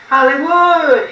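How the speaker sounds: greeting the city of Hollywood with a big call. Retold or imitated. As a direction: shout it out like stepping off the bus. Arriving in Hollywood, calling out.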